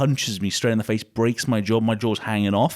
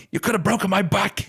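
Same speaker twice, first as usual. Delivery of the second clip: higher, whining hurt complaint; mock Elliot in pain; 10 semitones higher than usual. Brother on the floor complaining to him, mock hurt whine. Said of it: "And Elliot's on the floor like".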